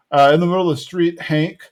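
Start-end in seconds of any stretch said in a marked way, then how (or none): none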